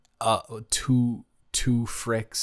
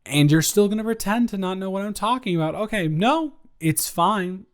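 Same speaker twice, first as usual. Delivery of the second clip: incredulous, pitched way up; 10 semitones higher, 4 dB louder than usual. Acted. Accusing the listener of playing dumb.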